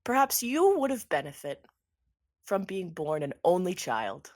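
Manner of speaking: lightly voiced villain, lilting upward; taunting villain. Villain suggesting to Anderson he was better an only child, taunting. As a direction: needle them with mock-polite suggestions.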